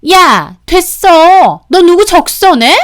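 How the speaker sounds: sudden high, angry shout; 버럭 소리치기. A narrator's reading. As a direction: blow up at her, loud and high. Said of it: "갑자기 급발진하며 소리를 지르는 겁니다". (갑자기 급발진하며 지르는 고함)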